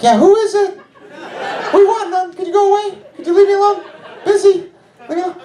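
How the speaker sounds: high, tense mock panic through a door; flustered calling out. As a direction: shoo away an intruder in a high-pitched voice. Acted answer from inside toilet stall.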